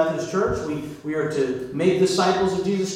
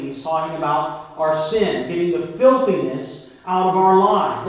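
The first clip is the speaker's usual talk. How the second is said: usual speaking voice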